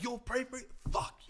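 high-pitched imitation of an angry man; mock furious outburst. Commenter reacting to being prayed for, mock outrage. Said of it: "that guy lost his shit".

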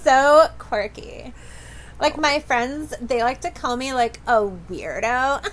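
mock influencer girl talking about herself. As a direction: play the quirky influencer girl. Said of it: "You do that voice, like, so well".

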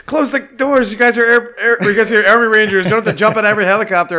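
high, loud barked orders, fast at the end; mock commanding officer. Friend imitating the character giving orders, loud and high. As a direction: bark it like a drill sergeant. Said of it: "or something he says".

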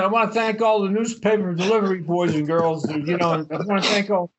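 high-pitched mock thank-you speech; mocking tribute. Mocks public thank-you speeches to heroes.

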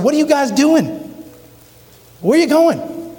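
high, startled question at the cockpit door; alarmed flight attendant. Retold or imitated. Flight attendant knocking on the pilots' door, high alarmed voice.

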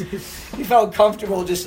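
ordinary talk, nothing marked

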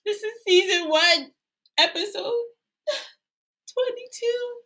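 high, wavering mock sob; hammed-up tearful announcement; mock choked up. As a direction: pretend to cry over the episode number. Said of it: "I'm so choked up already".

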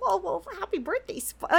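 high, soft silly character voice. Acted. Background pony wishing Spike happy birthday, silly. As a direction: put on a silly little greeting. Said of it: "a little silly".

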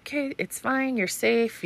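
put-on high, soft reassurance to a scared child; calm a frightened toddler gently; soothing. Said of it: "trying to explain to him".

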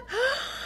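high gasp of delighted surprise; excited gasp. Shown as a demonstration. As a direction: gasp high, as if seeing a wonderful present. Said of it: "pongamos esa carita". (grito ahogado de emoción)

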